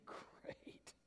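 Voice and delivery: whispered sinking thought; hushed, voiceless dismay. Retold or imitated. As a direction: breathe it out in private dread. His retold dismayed inner thought.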